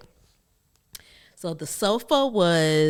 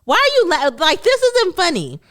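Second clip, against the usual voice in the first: high, loud upset protest; upset child; 12 semitones higher, 9 dB louder than usual. Retold or imitated. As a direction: wail it, hurt and indignant. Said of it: "I said".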